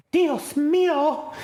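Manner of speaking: very high, slow, drawn-out gasp; mock horrified janitor. Janitor seeing the bloody washroom, high and drawn out. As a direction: stretch out the horror.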